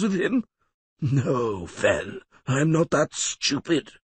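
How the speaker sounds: a hint of a sly old schemer; lightly voiced scheming lawyer. Codringer's scornful rant.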